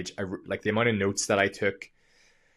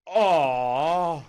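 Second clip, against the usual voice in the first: long, loud, high falling "aww"; imitated disappointed crowd "aww"; 7 semitones higher, 6 dB louder than usual. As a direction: groan like a let-down audience.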